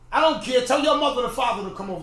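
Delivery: loud, high defiant shout; yelling at kids. Retold or imitated. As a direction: fire back at a kid at full volume. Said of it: "he was yelling at me".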